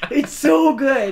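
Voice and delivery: high, loud, stretched-out rave; real excitement. Real, not acted. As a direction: rave about it, drawing the words out. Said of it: "I love how excited you get about it".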